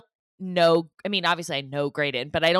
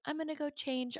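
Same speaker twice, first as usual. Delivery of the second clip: calm; 8 semitones higher, 12 dB quieter than usual. Retold or imitated. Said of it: "calmly be like".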